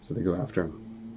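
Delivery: ordinary delivery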